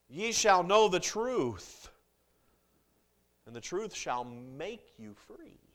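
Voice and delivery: preacher quoting John to the church; scripture recitation. Acted. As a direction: proclaim the verse deliberately.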